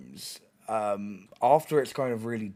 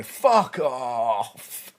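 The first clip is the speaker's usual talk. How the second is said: rave chav shout; 6 dB louder than usual. Retold or imitated. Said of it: "what all the fucking, like, chavas do in the rave".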